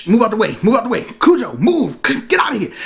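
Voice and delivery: high, urgent pleading calls; frantic shooing. Retold or imitated. Billy begging the dog to move, high and urgent. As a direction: shoo the dog away, desperate.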